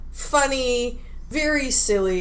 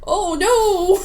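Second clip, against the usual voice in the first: mock dismay; 7 semitones higher, 5 dB louder than usual. Mock dismay at losing time.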